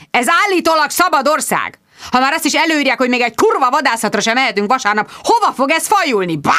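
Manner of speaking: high-pitched indignant rant; angry outburst. A narrator's reading. Hunter rants to his visitor about the hunting ban, angrily. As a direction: rage as an outraged squire. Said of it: "dühösen rám nézett".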